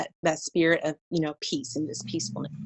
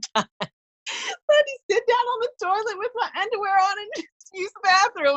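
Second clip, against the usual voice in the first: laughing while telling; 3 dB louder than usual. Real, not acted. Retelling embarrassing toilet moment, laughing.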